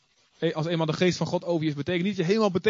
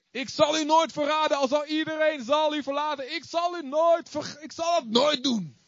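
higher, slow, pleading promise that he'll never betray; Peter's earnest vow; 10 semitones higher than usual. Acted. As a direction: swear it like an overeager disciple. (hoog en langzaam, een vurige belofte)

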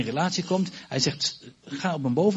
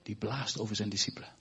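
hushed; 8 semitones lower, 10 dB quieter than usual. Real, not acted. Describing Jesus breathing on disciples.

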